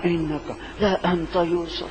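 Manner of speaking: soft, trembling mix of dread and hope; fearful hope. Acted. Brothers recognizing Yusuf, soft fearful hope. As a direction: barely dare to say it. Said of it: "یہ سارے جذبات ملے جو ہیں".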